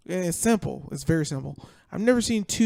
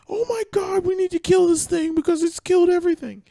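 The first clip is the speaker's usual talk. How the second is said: frantic high shout, much louder; panicked survivor; 12 semitones higher, 9 dB louder than usual. Retold or imitated. Retelling the stranded commodore's panic, frantic high shout. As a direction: squeal it out like a terrified commodore. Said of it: "he's like".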